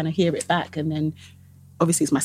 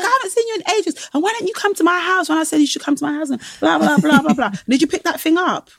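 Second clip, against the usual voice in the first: excited catch-up chatter; 9 semitones higher than usual. Acted. Acting out friends' catch-up chatter.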